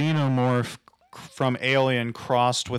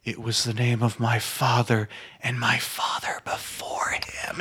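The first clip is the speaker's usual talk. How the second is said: hushed, whispered mock-ominous reply; whispered film line; 3 dB quieter than usual. Unmasked figure answering about his name, ominous whisper. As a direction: imitate a film character in a whisper. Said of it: "takes off the mask and he's like".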